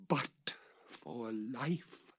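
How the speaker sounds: stretched out, pleading slowly; very slow plea. A narrator's reading. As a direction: linger on each word. Repeated, very slow.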